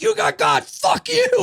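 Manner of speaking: high, loud shouted fuck you; shouted gotcha at a friend; gleeful shout. Retold or imitated. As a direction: yell it in triumph. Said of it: "I was like".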